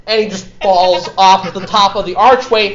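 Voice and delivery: ordinary talk, nothing marked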